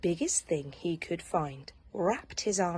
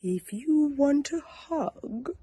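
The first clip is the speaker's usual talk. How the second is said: wise owl; 9 semitones higher, slower than usual. Acted. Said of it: "said a wise owl".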